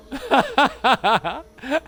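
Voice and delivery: a squealing burst of laughter; high delighted laugh. Real, not acted. Gamer seeing the huge metal drop, high delighted laugh. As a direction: laugh high at the absurd loot.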